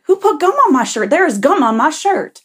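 high, loud mock outrage; hammed-up indignant yell, pitch jumping up; upset man. Teller mimics man finding gum on his shirt, upset outburst. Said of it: "he starts getting upset".

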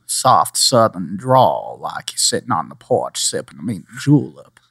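lazy porch-sitting Southern accent; soft Southern drawl. Shown as a demonstration. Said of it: "I was able to do both of those quite well".

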